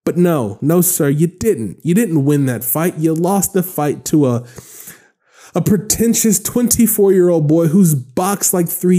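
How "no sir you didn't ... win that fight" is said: ordinary talk, nothing marked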